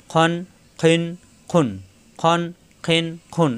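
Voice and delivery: "খন, খিন, খুন" with throaty kh; thick kh closing on a clear n; heavy kh drill. Shown as a demonstration. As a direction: round the vowel of "খন", never an open a. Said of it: "এভাবে উচ্চারণ হবে".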